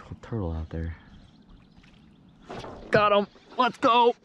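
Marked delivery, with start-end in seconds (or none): 2.9-4.2 high, loud shouts of triumph; real whooping excitement, pitched way up; excited yelling. Real, not acted. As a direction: yell in celebration as you hook one. Hooks a fish, celebrates.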